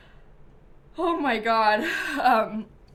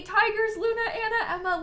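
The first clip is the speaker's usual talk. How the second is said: ordinary talk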